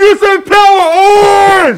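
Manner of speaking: high loud chant call; camp counselor cheer. Acted. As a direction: lead the crowd chant.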